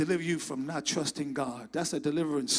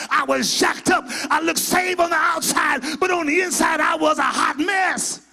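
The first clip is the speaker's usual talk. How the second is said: shouted testimony, building in force; preaching at full force; 8 semitones higher, 10 dB louder than usual. Acted. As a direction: stir the church up, louder and louder.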